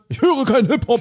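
high put-on cartoon voice; South Park character. Quoting a South Park character to the co-host, high and silly. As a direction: squeak it out like a cartoon kid. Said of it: "Ist wie bei South Park".

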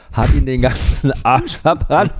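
laughing. Real, not acted. Laughing while telling the punchline.

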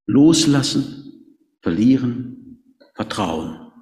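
slow, weighty words fading away; slow emphatic triad. Acted. Closing triad, slowing. (langsam, gewichtig, immer leiser)

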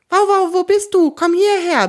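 roaring call. A narrator's reading. Kunibert searches for the dog Wauwau, a loud bellow. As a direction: yell for the dog at full voice. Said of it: "brüllt er so laut er kann".

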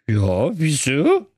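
tipsy, puzzled character voice; drunken slur. Acted. Codekäppchen answers Jakob's accusation, drunken slur. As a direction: slur it as if sozzled. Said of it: "lallte das Codekäppchen".

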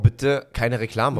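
slow, spelled-out reading; slow sign reading. Acted. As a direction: read each word slowly like a sign.

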